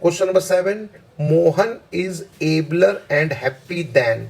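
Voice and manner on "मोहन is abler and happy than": slow, deliberate reading of the sentence; slow dictation. Shown as a demonstration. Teacher reading a test sentence slowly to students. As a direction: read it slowly so students can follow. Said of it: "मैं धीरे-धीरे बोल रहा हूँ".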